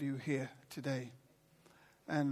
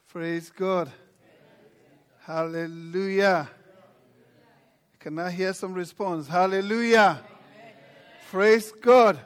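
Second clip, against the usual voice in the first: louder, building hallelujah shouts; rousing praise; 4 semitones higher, 13 dB louder than usual. Real, not acted. Preacher calling the congregation to praise, building to a shout. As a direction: stir the crowd to respond.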